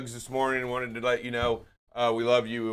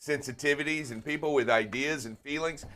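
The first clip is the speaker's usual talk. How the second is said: ordinary talk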